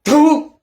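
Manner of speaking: loud disgusted spitting sound; contemptuous spit. Acted. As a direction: spit it out in scorn. Character spits in contempt.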